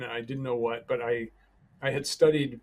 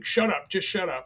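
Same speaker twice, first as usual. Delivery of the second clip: angry shout; 7 semitones higher, 5 dB louder than usual. Retold or imitated. Gunman snapping at speaker.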